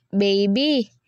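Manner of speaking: a lightly voiced bedroom tone on Baby. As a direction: draw him in with a hint of bedroom voice. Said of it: "he asked in his bedroom voice".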